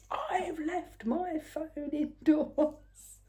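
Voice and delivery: panicky. Retold or imitated. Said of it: "in a very panicky voice".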